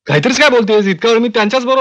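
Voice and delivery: high, quick disbelieving objection; alarmed and baffled, pitched well up; incredulous protest. Acted. Disbelieving protest at police summons news.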